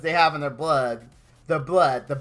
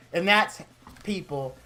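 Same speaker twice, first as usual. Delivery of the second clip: usual speaking voice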